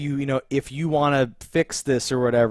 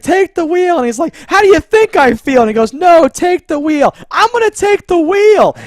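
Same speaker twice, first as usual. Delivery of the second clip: high, loud back-and-forth yelling; mock shouting match; 10 dB louder than usual. Two characters yelling in the car, high and loud. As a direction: bellow it over the chaos. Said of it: "when he's like".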